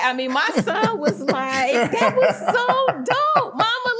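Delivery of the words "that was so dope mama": excited son. Retold or imitated. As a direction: imitate a kid gushing to mom, laughing. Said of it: "he was so excited".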